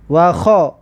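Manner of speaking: ordinary talk, nothing marked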